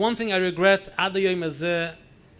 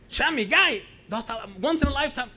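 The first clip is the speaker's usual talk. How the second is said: urging grandmother; 7 semitones higher, 3 dB louder than usual. Retold or imitated.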